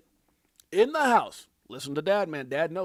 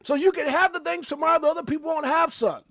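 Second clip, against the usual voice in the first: higher and louder fatherly lecture; a father earnestly urging his son to pay attention; voicing a dad; 9 semitones higher, 7 dB louder than usual. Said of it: "his dad is trying to say".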